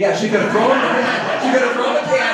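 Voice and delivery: loud, high, laughing agreement; amused loud laugh. Real, not acted. Laughing at audience joke.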